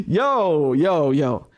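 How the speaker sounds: usual speaking voice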